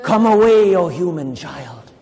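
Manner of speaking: loud beckoning call, then slow; beckoning fairy refrain. Acted. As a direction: call the child away. Calling the refrain.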